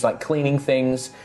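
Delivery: usual speaking voice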